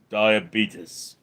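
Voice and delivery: a faint nod to Wilford Brimley saying "Diabetes". Pretend. Asked by chat to say "Diabetes" like Wilford Brimley, barely voiced. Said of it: "Say diabetes like Wilford Brimley".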